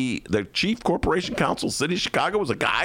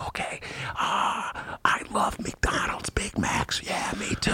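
hushed mock-confidential whisper; 5 semitones higher, 5 dB quieter, slower than usual. Adviser shares lunch tastes with the mayor, whispering. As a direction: whisper small talk like a state secret. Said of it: "whispers in the Lori Lightfoot's ear".

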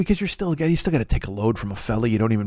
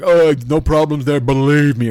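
louder, boastful Trump voice; Trump impression; 6 dB louder than usual. Trump bragging at the debate, loud and smug. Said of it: "he was just like".

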